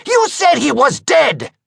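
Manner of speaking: high, loud screech; screeching soprano yell. Acted. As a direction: shriek it in a shrill panic. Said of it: "in a screeching soprano pitch he yells".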